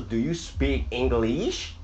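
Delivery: "English" ending in a long "ee", swooping sharply up; wrong pronunciation. Shown as a demonstration. Teacher shows what not to say, "English" drawn out and rising. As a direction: stretch "English" into "ing-gleesh" with exaggerated rise. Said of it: "你不能说".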